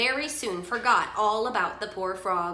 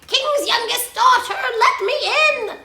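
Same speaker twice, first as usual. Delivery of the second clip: high, loud frog shout; demanding high-pitched frog cry; 10 dB louder than usual. A narrator's reading. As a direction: holler through a closed door. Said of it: "and a cry".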